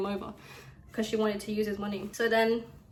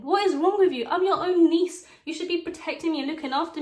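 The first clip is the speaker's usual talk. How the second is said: higher, louder indignant retort; mildly hammed-up fury at her aunt; retold outrage; 6 semitones higher, 5 dB louder than usual. Niece telling off her aunt, raised indignant voice.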